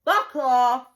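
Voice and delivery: loud, curt brush-off; blunt dismissal. Real, not acted. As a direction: bite it off loud and final. Rejecting men who want to marry her.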